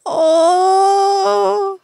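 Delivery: loud high startled cry; startled exclamation. Real, not acted. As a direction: yelp at the sudden dark. Startled by lights.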